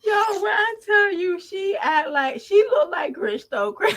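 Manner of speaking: high voice through laughter; trying to talk while cracking up; laughing. Real, not acted. Laughing fit.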